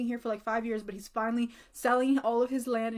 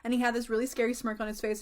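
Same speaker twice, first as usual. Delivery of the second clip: usual speaking voice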